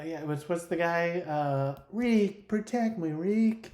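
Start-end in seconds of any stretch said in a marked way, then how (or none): 1.9-3.7 put-on higher-pitched creepy old-movie pleading; Ugarte. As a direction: plead like a creepy forties film weasel.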